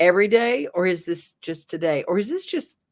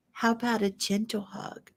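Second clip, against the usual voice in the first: put-on higher and softer, coaxing little girl; gentle child's offer; 5 semitones higher, 6 dB quieter than usual. The little girl softly offers the sulking boy a gentle hug, tender. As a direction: coax him softly like a small girl.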